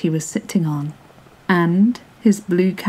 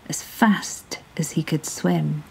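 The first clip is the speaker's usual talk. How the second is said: plain reading voice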